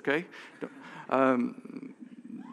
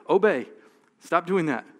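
loud, higher stern command; stern rebuke; 4 semitones higher, 6 dB louder than usual. Retold or imitated. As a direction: bark it out like a scolding parent. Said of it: "He reminds them of this story".

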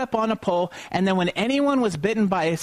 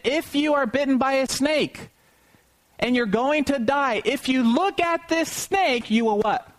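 lightly voiced Moses, higher and rising as he calls out; a hint of Moses calling to the people; Moses proclaiming; 6 semitones higher than usual. Moses addresses the Israelites.

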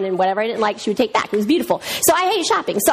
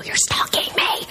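mock whisper; 3 dB quieter than usual. Imagined stranger accusing her.

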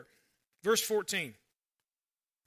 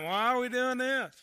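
higher-pitched mock grumbler; nitpicky questioning; 4 semitones higher than usual. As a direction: play a put-out church member questioning everything. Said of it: "Just nitpicky questioning all the time".

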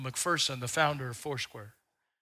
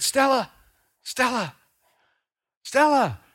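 loud high calls of Stella; yelling her name; 10 semitones higher, 8 dB louder than usual. Retold or imitated. Pastor yelling at an old woman who can't hear him. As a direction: shout the name to reach a deaf old lady. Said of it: "I was yelling at the top of my voice".

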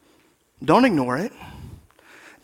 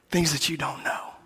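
hushed whisper for mystery; 2 semitones lower, 10 dB quieter than usual. Acted. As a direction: breathe the words like a secret. Preacher drops to whisper.

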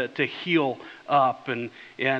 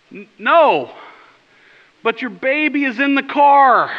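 higher, louder, drawn out; insistent call; 8 semitones higher, 7 dB louder than usual. Retold or imitated. Answering the father across the lot, louder insistent call. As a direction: stress the baby, exasperated. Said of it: "And I said".

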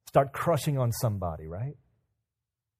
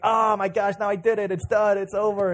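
higher, flustered outburst; mock panic; 5 semitones higher, 4 dB louder than usual. Girl who just liked his status, mock panic. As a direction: play up the instant regret.

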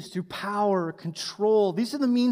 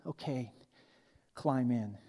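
put-on much lower, soft, dry old voice; old man punchline; 9 semitones lower, 9 dB quieter, slower than usual. Old man tells the young boaster to climb in, low and dry.

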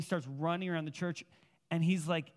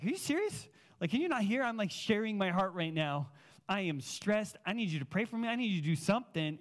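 higher-pitched exasperated pleading; annoyed; 4 semitones higher than usual. Retold or imitated. Sharing his heart with his buddy, annoyed. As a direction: protest in mock disbelief, voice jumping up. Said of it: "I was, like, annoyed".

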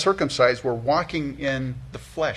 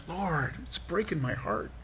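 heartbroken murmur; 2 semitones higher, 9 dB quieter than usual. Acted. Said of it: "rather than it's like".

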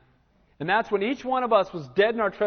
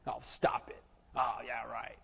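breathy whispered scoff, much softer; scoffing dismissal; 7 semitones lower, 13 dB quieter, slower than usual. Retold or imitated. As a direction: brush it off under your breath. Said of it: "have them laugh at you".

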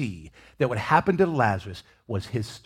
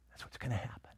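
hushed whispered promise; 2 semitones lower, 17 dB quieter, faster than usual. Acted. Paul telling believers their journey, whispered. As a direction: let it fall to a whisper. Paul's words whispered to listeners.

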